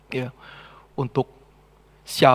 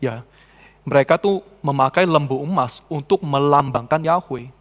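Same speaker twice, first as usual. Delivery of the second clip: usual speaking voice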